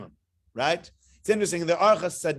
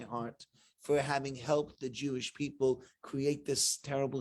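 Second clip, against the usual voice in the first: false contrition; 5 semitones lower, 4 dB quieter than usual. Retold or imitated. Aaron voiced with fake showy remorse, much softer. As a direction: lay the guilt on thick, hushed and hollow.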